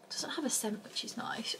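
soft unvoiced whisper. Real, not acted. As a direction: hush it, barely breathed. Testing product on hand, hushed.

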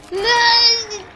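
high, loud, drawn-out cry; long high-pitched wail; dismayed scream. Real, not acted. Hit by enemy in game.

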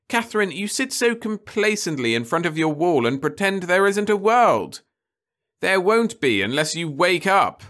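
urgent mother. A narrator's reading. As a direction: shake her awake with the facts.

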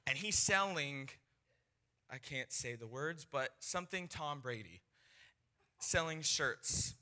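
ordinary talk, nothing marked

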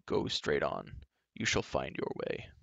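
a low, soft sorrowful giant. A narrator's reading. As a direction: speak low and mournful, like a sad giant. Sad giant Glue speaks.

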